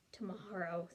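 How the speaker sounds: said softly and quiet. A narrator's reading. Jack speaking softly to Annie about tomorrow. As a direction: hush it gently. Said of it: "Jack said softly".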